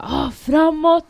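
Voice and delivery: high, drawn-out retold order; acted battle cry to advance; shouted command. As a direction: call it out long and loud. Retold order to push forward.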